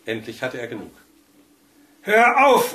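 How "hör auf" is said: "Hör auf" bellowed much higher and louder, nerves shot; frazzled bellow. A narrator's reading. Husband roars at the crackling radio, a frantic bellow. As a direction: roar "Hör auf" at the radio, at the end of his rope. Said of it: "brüllte er völlig entnervt".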